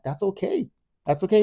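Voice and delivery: high, airy, unbothered; carefree retort. Retold or imitated. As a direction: brush off the critics breezily. Defiant retort to critics.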